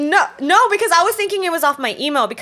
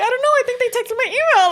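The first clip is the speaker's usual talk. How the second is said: high-pitched, flustered answer; mock helpless squeal without extra volume; retold panic; 9 semitones higher than usual. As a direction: play the panicked friend, pitched way up. Acted panicked answer to friends.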